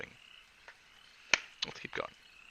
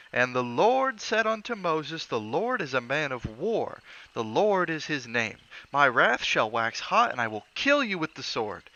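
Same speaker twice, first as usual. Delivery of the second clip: high, slow, booming sermon voice; bellowing preacher; 6 semitones higher, 5 dB louder, slower than usual. Acted. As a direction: thunder out scripture like a pulpit preacher. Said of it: "even when he bellowed".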